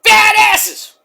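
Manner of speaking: very high furious shouted insult; Scott Steiner shout. Retold or imitated. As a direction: bellow it like the ranting uncle. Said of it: "like his uncle Scott Steiner".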